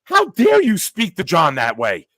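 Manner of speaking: high, slow, scandalised scolding; hammed-up righteous horror; mock outrage. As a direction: overdo shocked indignation at your mother. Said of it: "I look and I go".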